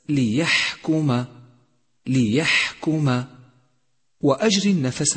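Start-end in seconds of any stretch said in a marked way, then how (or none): 0.1-3.2 ليحكم slow, with the ح breathed out; breathy H demo. Shown as a demonstration. Teacher modelling the whispered ح to learners, slow. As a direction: let air flow through the ح in ليحكم. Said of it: "لاحظ صفة الهمس لحرف الحاء".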